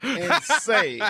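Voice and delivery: hammed-up angry rant; mock rant. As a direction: rant at callers like a grumpy host. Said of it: "I'm mad".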